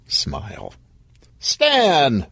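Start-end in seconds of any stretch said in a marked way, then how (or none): 1.4-2.3 high, falling hearty hello; delighted to see him; bright greeting. Acted. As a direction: call the name out brightly. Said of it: "Vic said brightly".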